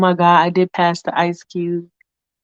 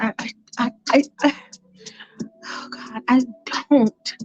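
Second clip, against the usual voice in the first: stammering, broken by pauses; flustered Michael; 3 semitones higher, 4 dB quieter than usual. Acted. Michael tempted but denying it, flustered halting stammer. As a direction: falter and lose your breath midway. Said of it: "And Michael's like".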